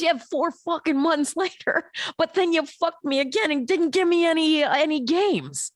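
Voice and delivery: put-on indignant little kid. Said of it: "And he goes".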